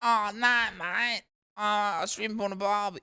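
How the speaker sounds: high, hammed-up Australian cliché. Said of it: "like, just, like, a stereotypical".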